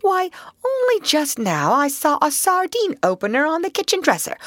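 high, brisk chiding; reproachful. Acted. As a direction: chide him back into action. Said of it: "said the Rat reproachfully".

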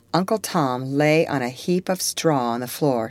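ordinary delivery

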